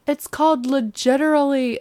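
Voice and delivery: high, bright tween voice, showing off; excited tween. Retold or imitated. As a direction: imitate an eager tween. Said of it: "she was like".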